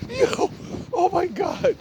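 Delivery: high laughing gasp; real laugh breaking through. Real, not acted. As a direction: still cracking up. Still laughing at the story.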